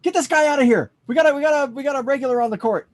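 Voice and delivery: high, loud shout to the guards; shouting guard call. Acted. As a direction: yell across the court to get him thrown out. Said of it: "He's like".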